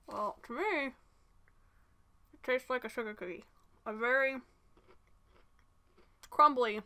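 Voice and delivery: chewing while talking; speaking between bites; mouth full. Real, not acted. Seen 1: Bites waffle at -5, hand at chewing mouth until 3.5.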